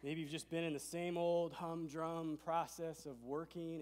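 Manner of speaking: usual speaking voice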